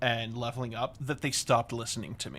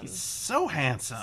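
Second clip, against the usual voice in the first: "so" stretched out, higher in pitch; stress on SO; 4 semitones higher, slower than usual. Real, not acted. Fan insisting on a favorite character's looks, stress on "so". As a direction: lean long and hard on "so", gushing. Gushing, repeating it for emphasis.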